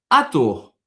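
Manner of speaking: "Ator" said loud and crisp; Portuguese word. Shown as a demonstration. Teacher gives the next quiz word, loud clear Portuguese. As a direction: present "Ator" loudly. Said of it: "un autre exemple".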